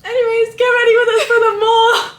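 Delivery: loud, high hype opener; excited vlog intro. Real, not acted. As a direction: shout it to your viewers.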